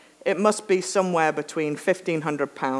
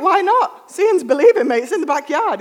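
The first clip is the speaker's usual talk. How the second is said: cheerful confidence; 10 semitones higher, 5 dB louder than usual. Retold or imitated. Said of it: "He's like".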